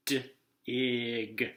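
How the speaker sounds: d-ig split into separate sounds, d then ig; sounding out. Shown as a demonstration. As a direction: blend d-ig slowly, sound by sound. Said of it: "we go".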